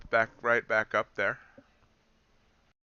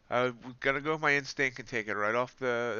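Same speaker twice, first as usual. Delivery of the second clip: normal talking voice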